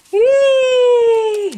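high, loud shout of joy; cheer. Real, not acted. Cheer after unboxing.

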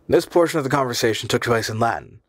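ordinary delivery, nothing marked

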